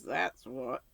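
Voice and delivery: lightly voiced gossipy neighbour saying "That's what"; Missus Rachel's "That's what". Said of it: "I love the way she says".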